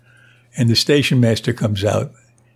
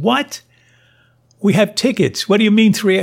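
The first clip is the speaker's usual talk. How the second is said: much higher, incredulous outburst; retold disbelief, pitched way up; shocked disbelief; 9 semitones higher than usual. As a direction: recreate the shock of a missed train. Told the train already left.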